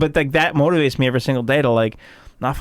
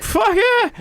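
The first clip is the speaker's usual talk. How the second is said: much higher, excited yelp; mock eager cheer. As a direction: cheer it like a kid offered candy. His eager reply to tequila.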